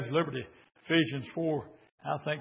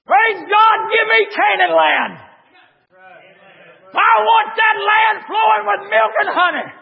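very high, loud, whooping revival shout; full force preaching; 13 semitones higher, 15 dB louder than usual. Acted. Preacher exults to the church over God's victory, whooping.

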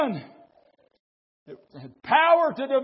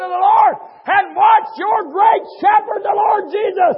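loud, raised, driving sermon delivery; fiery pulpit shouting; full-force preaching; 6 semitones higher, 8 dB louder than usual. Real, not acted.

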